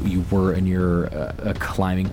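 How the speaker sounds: ordinary delivery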